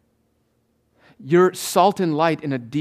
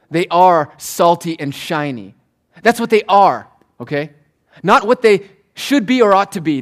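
normal talking voice

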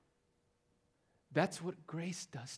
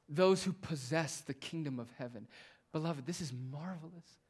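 ordinary talk

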